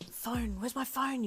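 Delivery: high, whispered panicked search; frantic. Acted. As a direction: hunt for it in a panic. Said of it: "you thought frantically".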